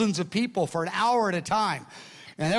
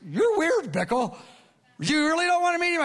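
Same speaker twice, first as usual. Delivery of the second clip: incredulous; 10 semitones higher than usual. Retold or imitated. Usher baffled by the refusal.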